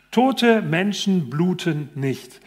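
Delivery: slow, deliberate textbook reading; reading aloud slowly. Retold or imitated. Doctor reads the textbook line slowly to the patient. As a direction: spell it out word by word for him.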